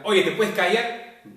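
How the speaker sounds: loud, high demand for silence; slow, emphatic telling-off at high volume; loud rebuke. Shown as a demonstration. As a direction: raise your voice sharply to shut someone up. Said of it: "Cambia, ¿verdad?".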